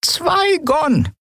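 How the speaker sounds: put-on old woman voice. Old woman names price.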